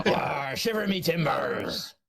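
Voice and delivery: pirate. Pretend. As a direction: drag it out like a hammy pirate. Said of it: "more like a fucking pirate".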